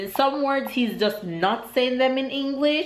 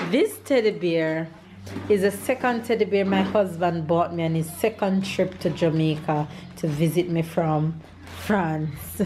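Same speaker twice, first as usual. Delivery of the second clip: ordinary talk, nothing marked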